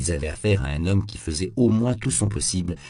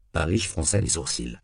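ordinary delivery, nothing marked

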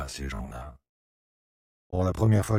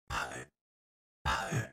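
muttering; 2 semitones lower, 5 dB quieter than usual. A narrator's reading. Boy refusing to harm the millers' home, muttering. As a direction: mutter it softly, eyes down. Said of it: "Il marmonnait les yeux baissés".

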